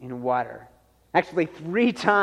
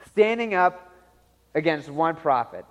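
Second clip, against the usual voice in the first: ordinary delivery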